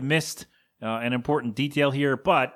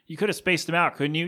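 ordinary talk, nothing marked

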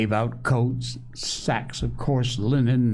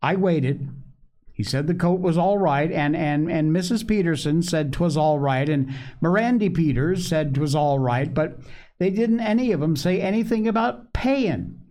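put-on high-pitched child telling his tale; small boy Joel, eager; 7 semitones higher than usual. A narrator's reading.

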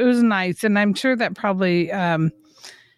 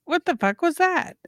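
mock disbelief; 6 semitones higher than usual. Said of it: "I was like".

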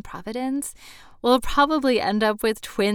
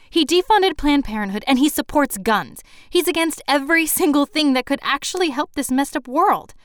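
loud, raised-pitch indignation; heated rant; 7 semitones higher, 8 dB louder than usual. Acted. Too late to stop her tirade about the politician, heated. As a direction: press on indignantly, not to be stopped.

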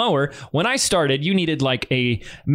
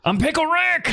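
much higher cartoon voice, laughing through it; Rick from Rick and Morty; 14 semitones higher than usual. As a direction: squeal it out as the cartoon scientist. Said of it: "my best impression of doing Rick".

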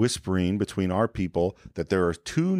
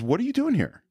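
officer questioning; 12 semitones higher than usual. Retold or imitated. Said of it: "the next thing he asks is".

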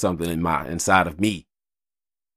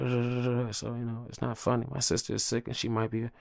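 ordinary talk

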